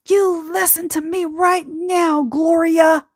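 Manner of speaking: high, sharp mock scolding from mom; stern scolding mom. Mother scolding, voiced in story.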